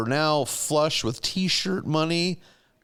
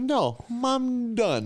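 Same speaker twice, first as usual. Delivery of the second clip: higher, drawn-out retold refusal; slow, raised-pitch mock declaration; mock defiant quitting; 9 semitones higher, much slower than usual. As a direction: imitate someone grandly quitting a job. Acted quote of Homer quitting work.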